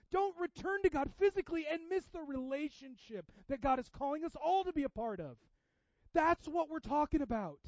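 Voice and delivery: loud, high-pitched exhortation; impassioned, sustained pulpit shout; full-force preaching. Real, not acted. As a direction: preach it urgently at full volume.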